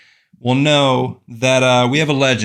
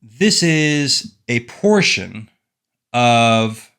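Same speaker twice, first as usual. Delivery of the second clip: slow, grand reading voice; slow solemn recital; much slower than usual. Acted. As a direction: read it like an ancient text. Dramatic slow opening read.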